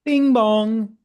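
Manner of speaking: high sung two-note chime; door chime. Pretend. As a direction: sing it like the shop door chime.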